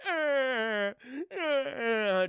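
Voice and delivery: high, loud imitated wail; jeering, drawn-out mock cries; mocking scream. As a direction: wail in mockery of a screaming captive. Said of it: "he starts mocking her".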